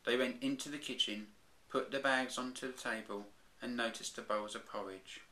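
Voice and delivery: plain reading voice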